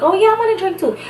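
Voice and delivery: high, eager copycat voice; mock a friend who copies everything; copycat mimicry. Mimicking Natalie copying her.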